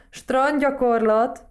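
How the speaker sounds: high, loud, drawn-out pebble voice; talking pebble. A narrator's reading. Talking pebble adding to the toad's answer, slow high chime-in. Stone chimes in after toad.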